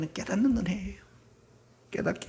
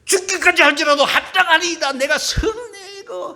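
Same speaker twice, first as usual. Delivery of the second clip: much higher, louder defiant yell; stubborn Jonah shout; 10 semitones higher, 7 dB louder than usual. Retold or imitated. Jonah shouting defiance at God, stubborn and angry. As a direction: shout it back like a sulking prophet. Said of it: "이런 고집 한번 보세요".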